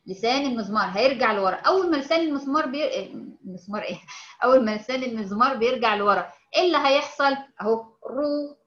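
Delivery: ordinary delivery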